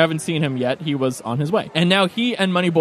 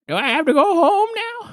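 high, whiny creature plea; high pleading squeak, near tears; 11 semitones higher than usual. Acted. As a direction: whine it like a sad pet. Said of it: "look at you, [name], and go".

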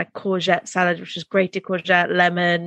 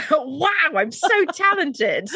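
higher, giggly self-mocking brag; laughing mock boast; 8 semitones higher, slower than usual. Real, not acted. Laughing at her own bad accents, mock proud. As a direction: laugh through a fake brag.

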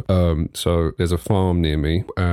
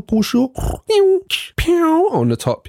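slow, much higher squeaky pew sound effect; 4 dB louder than usual. Shown as a demonstration. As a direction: mimic the spray of a baster. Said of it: "give it a little pushu".

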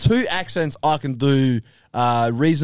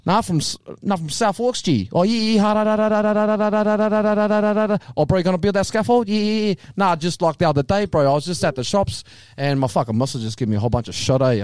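Maori scaffolder banter, slightly raised pitch; Maori accent; 5 semitones higher than usual. Shown as a demonstration. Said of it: "the other one is Modi".

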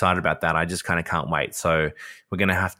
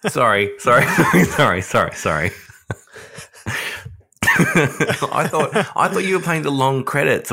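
laughing; 7 semitones higher, 5 dB louder than usual. Real, not acted. Caught out, laughing apology.

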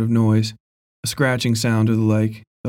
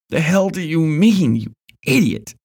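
angry; 6 semitones higher than usual. Acted. Cap angrily answers Ben.

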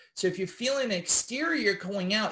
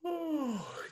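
demo yawn; 7 semitones higher, 7 dB quieter than usual. Shown as a demonstration. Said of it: "when we yawn".

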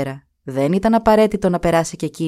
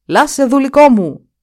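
loud cry, higher and louder; shouting; 7 semitones higher, 8 dB louder than usual. Acted. The Duke cries out for his servant Lasse, loud and high. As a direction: call out for the lost servant. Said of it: "Με μιας ξεφώνησε".

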